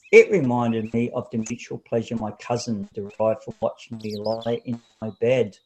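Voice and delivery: usual speaking voice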